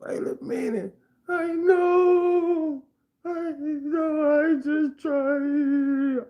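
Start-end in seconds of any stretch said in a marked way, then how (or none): 1.3-6.2 high falsetto blubbering, dragged out slow; mock sobbing. The crying neighbor thanking him, in a high mock sob. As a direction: whine it through fake tears, very high.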